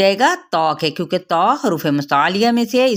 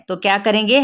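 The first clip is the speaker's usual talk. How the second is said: normal talking voice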